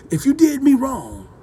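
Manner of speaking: ordinary delivery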